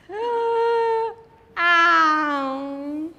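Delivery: loud, high mock tantrum cry; child's tantrum wail. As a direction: wail like a toddler starting a tantrum. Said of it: "that is when she starts".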